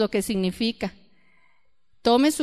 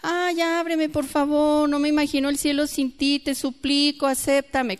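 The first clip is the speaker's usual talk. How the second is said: higher, pleading whine as a mocking imitation; mock whining; 4 semitones higher than usual. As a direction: beg to be let in, hammed-up and whiny. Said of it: "lloriqueando allá afuera".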